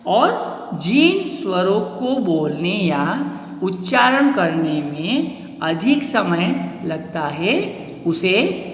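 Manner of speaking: slow, spaced words; slow dictation. Shown as a demonstration. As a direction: spell out the definition slowly. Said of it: "जिनके उच्चारण में कम समय". (धीमी, स्पष्ट परिभाषा)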